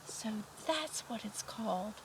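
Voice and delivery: slow, wondering mole voice; lightly voiced dawning mole; Mole realising. Mole learns the moon's name.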